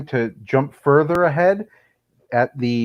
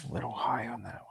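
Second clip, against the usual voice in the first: golf commentator whisper; 16 dB quieter, faster than usual. Acted. Hushed putting commentary.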